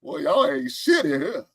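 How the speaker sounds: ordinary delivery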